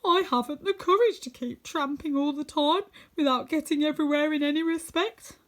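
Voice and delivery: the cowardly lion moaning he can't go on; whimpering Cowardly Lion. Acted. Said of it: "with a whimper".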